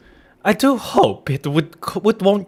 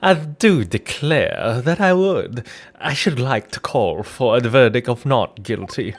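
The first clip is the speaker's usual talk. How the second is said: put-on southern belle accent; 4 semitones lower, 4 dB quieter than usual. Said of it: "she has, like, a southern accent now".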